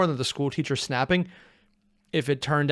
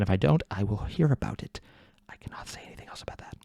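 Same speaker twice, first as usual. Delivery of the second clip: mock secret whisper; 5 semitones lower, 5 dB quieter than usual. Joking about family hearing him.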